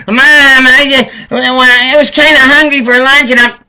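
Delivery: put-on high, loud whining child voice; whiny kid. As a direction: whine like a hungry eight-year-old. Hungry kid whining at mom.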